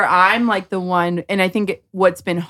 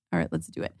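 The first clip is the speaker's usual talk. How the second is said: deep mock grumble; 14 semitones lower, 12 dB quieter, much faster than usual. Mom answering her child's talk about feelings, low mock grumble. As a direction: voice dropped much lower and softer, grudging.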